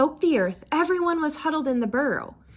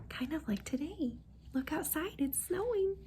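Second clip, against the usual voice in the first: soft aside; 4 semitones higher, 11 dB quieter than usual. Real, not acted. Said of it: "reader aside to child".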